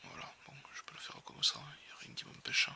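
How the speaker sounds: whispered to himself; barely voiced, under the breath; whispering. Real, not acted. Muttering to himself while opening.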